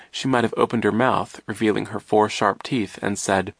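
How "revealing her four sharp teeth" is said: usual speaking voice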